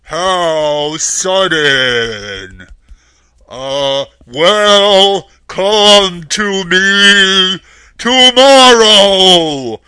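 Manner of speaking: very loud, slow, drawn-out boom; huge wizard voice, stretching every word; booming wizard Oz, stalling. A narrator's reading. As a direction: stall grandly in a thundering voice.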